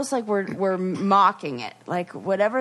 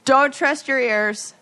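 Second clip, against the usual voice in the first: louder, higher ominous warning; mock warning; 5 semitones higher, 5 dB louder than usual. Host warning listeners in a hammed-up, louder and higher voice. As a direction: declare it like a spooky slogan. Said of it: "podcast catchphrase warning".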